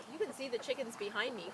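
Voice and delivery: usual speaking voice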